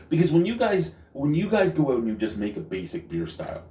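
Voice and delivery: ordinary talk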